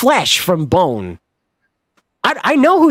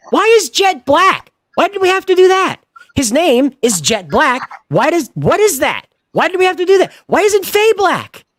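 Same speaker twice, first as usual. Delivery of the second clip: outraged rant; 10 semitones higher than usual. Real, not acted. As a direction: rant in high-pitched disbelief. Ranting about casting choices.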